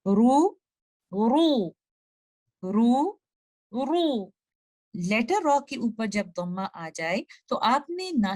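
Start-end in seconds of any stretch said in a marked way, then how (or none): 0.1-4.3 रू said in turns, light then heavy; contrasting ru sounds. Shown as a demonstration. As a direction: alternate a thin रू with a dark, rounded रू. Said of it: "दोनों sounds को एक साथ आपको पढ़ के सुनाती हूँ".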